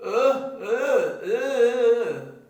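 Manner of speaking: loud, strained अ without lips; lipless sounds. Shown as a demonstration. Teacher demonstrates useless mouth without lips, straining. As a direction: try to speak with both lips gone. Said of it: "ये बेकार हो गया".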